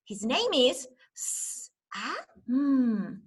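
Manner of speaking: "S-", "ah", "mm" drawn out slowly as separate phonemes; segmented sounds. Shown as a demonstration. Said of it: "I'll help you".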